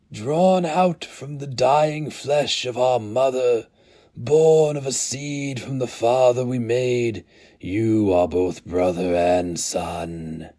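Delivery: high, slow, solemn chanting elder; ancient Inkoroi elder. Acted. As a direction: intone it like a ritual blessing over newborns.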